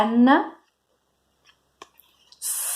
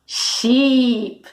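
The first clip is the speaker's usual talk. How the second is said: ordinary talk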